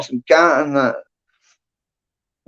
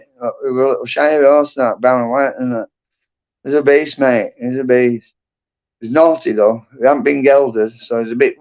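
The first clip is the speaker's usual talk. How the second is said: ordinary talk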